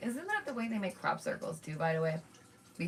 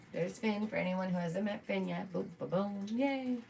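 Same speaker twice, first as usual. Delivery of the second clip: normal talking voice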